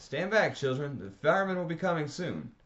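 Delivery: put-on loud warning to children; shouting. A narrator's reading. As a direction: shout the kids back from the fire. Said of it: "Then to the kids he shouted".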